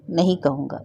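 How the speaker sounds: ordinary delivery, nothing marked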